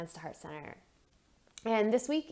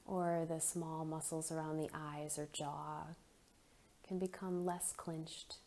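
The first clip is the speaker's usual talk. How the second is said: soft, unhurried meditation voice with long pauses; guided meditation; 6 dB quieter than usual. Acted. Leads a guided breathing meditation.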